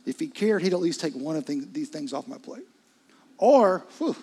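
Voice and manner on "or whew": sharp loud exhale of frustration; loud weary whew; exasperated whew. Real, not acted. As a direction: blow out air like it's too much. Exasperated exhale mid-sermon.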